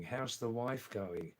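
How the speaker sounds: usual speaking voice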